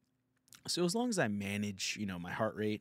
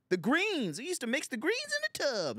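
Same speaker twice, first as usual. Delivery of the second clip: high, loud sassy counter-lady voice; Popeyes lady voice; 6 dB louder than usual. Acted. Mocking a restaurant lady, in a high loud sassy voice. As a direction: ham up a soul food counter lady calling customers in. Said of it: "She talks like the, the Popeyes lady".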